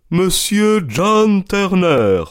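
lent, haut et fort, comme un valet qui annonce; annonce du garçon d'hôtel. Acted. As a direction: proclamer le nom à la porte, très appuyé. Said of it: "annonça le garçon d'hôtel". (annonce lente et sonore du valet d'hôtel)

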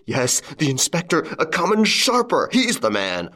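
high, agitated outcry easing down; screamed. A narrator's reading. Wife accusing the false inspector, crying out. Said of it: "she screamed".

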